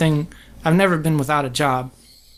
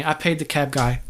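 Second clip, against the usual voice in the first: ordinary delivery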